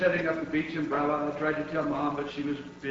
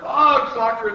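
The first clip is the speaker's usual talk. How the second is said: louder, higher echoing yell; crew's yell; 6 semitones higher, 10 dB louder, slower than usual. A narrator's reading. Crew echoing captain on deck, loud high yell. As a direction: yell it back as an excited crew. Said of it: "the crew yelled".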